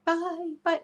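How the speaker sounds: high, airy goodbye; playful high-pitched farewell into the distance; mock goodbye. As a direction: call bye as if drifting away. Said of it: "like that".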